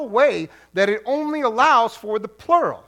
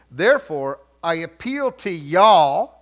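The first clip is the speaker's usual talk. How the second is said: slow, drawn-out Southern reading; Southern accent; 4 semitones lower, 6 dB louder, slower than usual. Pretend. Preacher reading Paul as a Southern translation, Southern accent. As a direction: stretch it into a Southern drawl. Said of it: "bust out a little bit of Southern talk".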